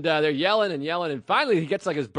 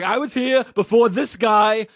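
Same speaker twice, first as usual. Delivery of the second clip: angry customer; 3 semitones higher, 7 dB louder, slower than usual. Retold or imitated. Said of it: "He's like".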